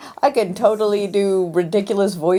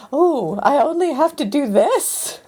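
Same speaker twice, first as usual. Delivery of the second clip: higher-pitched dreamy delight; imagine a lovely easy life, pitched up; delighted relief; 8 semitones higher than usual. Pretend. Imagines having only one job.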